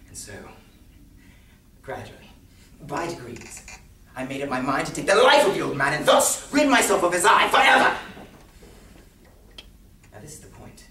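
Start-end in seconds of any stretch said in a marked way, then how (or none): 4.1-8.0 theatrical madman's outburst; murderous resolve. Acted. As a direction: build to a loud, raised cry. Declares his resolve to kill.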